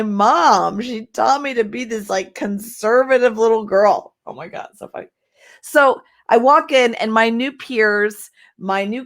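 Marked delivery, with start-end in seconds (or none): 4.3-5.1 whispered aside. Real, not acted. Laughing aside at memory.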